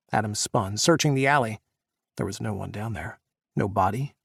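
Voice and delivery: ordinary delivery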